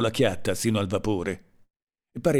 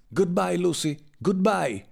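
shouted farewell; 8 semitones higher, slower than usual. A narrator's reading. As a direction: call out farewell in a raised pitch. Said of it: "gridò Kersdale". (grido d'addio in tono acuto)

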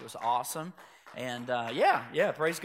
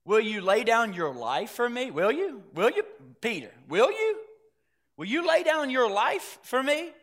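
probing, repeated challenge, pitched up; lightly acted doubting questions, rising higher; Jesus questioning Peter; 6 semitones higher, 3 dB louder than usual. Lord questioning a disciple's vow, pointedly doubtful.

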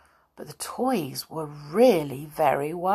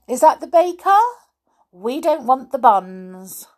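grand phone voice; 7 semitones higher, 6 dB louder than usual. Acted. Amelia fakes phone calls again.

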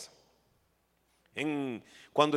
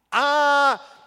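surprised ah; 9 semitones higher, 8 dB louder than usual. Acted. Jesus reacting to the disciples.

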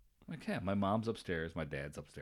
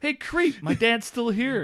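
mock yell; 14 semitones higher, 10 dB louder, slower than usual. Yelling at creepy neighbour.

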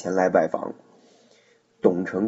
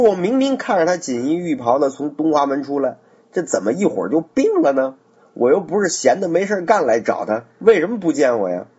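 raised pitch, sharp indignant complaint; Ma Teng flares up, faster at the end; angry outburst; 6 semitones higher, 3 dB louder than usual. Acted. Ma Teng shouting at the servants who turned him away, indignant. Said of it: "一下就火了".

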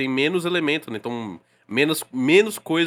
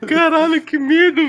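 high, loud mock scream of fear; mock fright; 13 semitones higher, 7 dB louder, slower than usual. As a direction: yelp like you got spooked.

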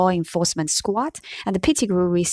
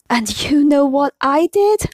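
raised, higher voice; roaring; 7 semitones higher, 4 dB louder than usual. Acted. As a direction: let Hagrid bellow it out. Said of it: "Hagrid roared".

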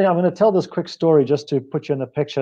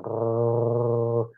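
soft, low imitation of a small dog's growl; 4 semitones lower, 6 dB quieter than usual. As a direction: growl quietly like an annoyed little dog. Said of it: "he just looks at me and goes".

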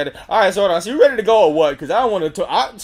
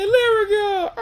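mock sob; 14 semitones higher, much slower than usual. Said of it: "They was like".

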